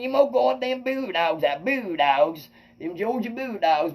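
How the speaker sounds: high, slow, lightly voiced old man; old Larry McKinney. Quoting an old neighbor about his pit bulls, slow and high. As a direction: draw out the Bulldogs like an old fan. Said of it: "He said".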